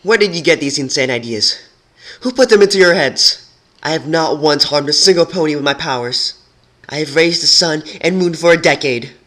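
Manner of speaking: high, loud frustrated outburst; frustrated shout. Acted. Twilight defending herself to the rebels, frustrated shout. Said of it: "a frustrated shout".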